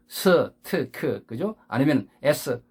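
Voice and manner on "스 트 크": 스, 트, 크 each with a full Korean 으 vowel; forced Korean-style letter sounds. Shown as a demonstration. Teacher mimicking learners' forced sounds, Korean-style. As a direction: add a vowel after every consonant. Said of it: "억지로 하다 보면은 이런 발음".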